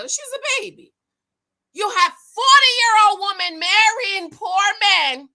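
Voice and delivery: ordinary talk, nothing marked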